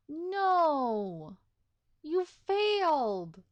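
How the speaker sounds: loud, slow wail of dismay; bitter outcry. Real, not acted. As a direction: cry out at the failed bodyguard. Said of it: "I'm so bitter right now".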